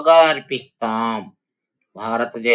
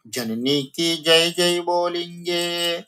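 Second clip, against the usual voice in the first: raised, sing-song recital of the line; poem recitation; 7 semitones higher than usual. Shown as a demonstration. Teacher reciting the poem line to her students, sing-song. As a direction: chant the verse to the class.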